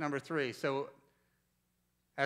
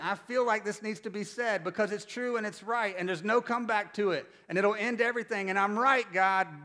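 mock-insistent plea, pitched up and louder; insistent self-justifying; 5 semitones higher, 4 dB louder than usual. Preacher replaying his argument with God, insistent. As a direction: argue your case urgently, retelling it.

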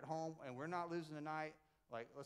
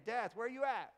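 loud mocking trash talk, raised high; Paul taunting death; 4 semitones higher, 5 dB louder than usual. Paul trash-talking death, loud mocking taunt. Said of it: "He's saying".

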